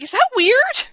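high-voiced quote, rising to the end; retold incredulous question. Miz asking his driver, mock puzzled.

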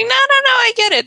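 high-pitched placating protest; hasty reassurance. Retold or imitated. Husband assuring wife of no screw-ups, hasty high reassurance. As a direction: imitate a husband promising too eagerly.

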